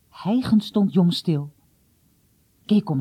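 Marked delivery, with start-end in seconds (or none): none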